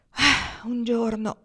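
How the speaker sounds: high, loud sighing start; long-suffering exclamation, kept patient. A narrator's reading. Pocket answering a defensive Marnie, patient. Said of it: "rispose Pocket pazientemente".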